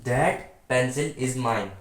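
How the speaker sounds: each word of "That pencil is mine" spaced out very slowly; slow, clear board reading for students; slow dictation. Shown as a demonstration. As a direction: read the example sentence aloud as if the class is writing it down. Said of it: "मैंने अभी कुछ examples लिखी हैं".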